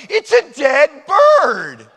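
high, loud, slow mock-British shout; Monty Python quote. Preacher quoting Monty Python to the congregation, loud mock shout. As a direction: bark it like the sketch's shopkeeper. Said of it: "To paraphrase Monty Python".

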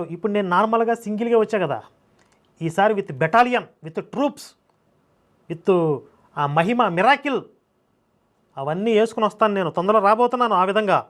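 normal talking voice

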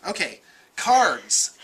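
ordinary talk

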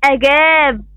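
"أجاب" with the long ا held out; teacher's stretched alif in "أجاب"; long alif madd. Shown as a demonstration. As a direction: draw out the ā in "أجاب" for the class. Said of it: "بها مد بالألف".

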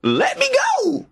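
very high-pitched squealed plea; distressed rabbit. A narrator's reading. Rabbit stuck in tar demands release, high squeal.

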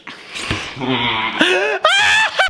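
very high squeaky laugh; squeaky helium laugh. Real, not acted. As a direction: laugh with a chipmunk voice.

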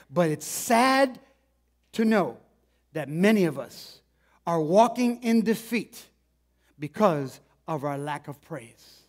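slow, swelling preacher cadence; preaching. Acted. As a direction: drive each phrase home from the pulpit. Preaching the lesson of the story.

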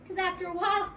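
ordinary delivery, nothing marked

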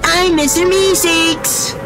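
slow, very high cartoon squeal; Mister Meeseeks. Streamer repeats his running impression, high cartoon voice. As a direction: stretch out the name in a high character voice. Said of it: "I really am Mister Meeseeks".

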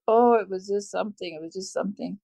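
ordinary talk

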